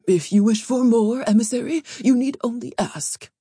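high, obsequious courtly voice; fawning, lilting host; ingratiating palace lord. A narrator's reading. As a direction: press the offer on an honored guest. Palace lord presses gifts on guest.

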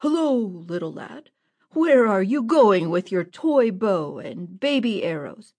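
a burly bandit, booming and mocking; a big bully sneering at a little lad; big man hailing mockingly. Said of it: "hailed him".

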